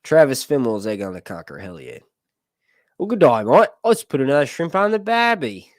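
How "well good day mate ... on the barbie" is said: loud, high, cliched Aussie greeting; booming caricature of an Australian; mock Australian accent. Cliche Australian phrases after accent attempts.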